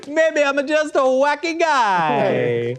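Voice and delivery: high, slow, silly voice; goofy mimic voice. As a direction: play it up as a wacky guy. Host mimics the leaning tower.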